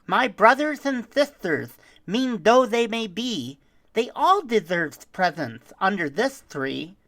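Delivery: put-on high, earnest child voice; brave little girl. Young girl speaks.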